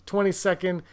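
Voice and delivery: normal talking voice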